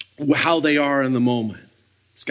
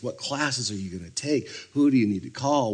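ordinary talk

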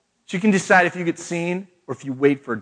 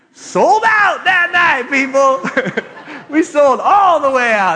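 high, slow triumphant shout through laughter; shouted brag, drawn out and laughing; excited shout; 9 semitones higher, 4 dB louder, much slower than usual. Real, not acted. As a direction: crow about the sellout, laughing. Boasting the chocolate sold out.

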